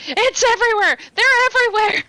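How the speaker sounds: high, loud frantic scream; raving, high-pitched cry of alarm; panicked screaming. Acted. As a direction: shriek it in terror as if just revived. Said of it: "just start screaming over and over again".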